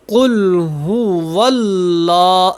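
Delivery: هو اللہ recited slowly, هو clear with و; slow recitation. Shown as a demonstration. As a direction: stretch out هو اللہ in tajweed. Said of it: "میرے ساتھ ساتھ پڑھیں". (آہستہ تجوید کے ساتھ تلاوت)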